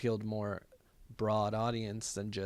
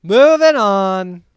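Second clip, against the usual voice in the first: drawn-out, high, booming call; loud topic change; 9 semitones higher, 18 dB louder, much slower than usual. Pretend. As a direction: holler it long and high to change the subject. Loudly calls a topic change.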